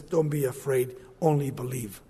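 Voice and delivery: calm a grieving father; Jesus gently reassuring the grieving father; soft reassurance. Acted. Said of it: "Jesus turns to this man and says".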